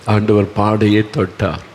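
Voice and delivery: ordinary talk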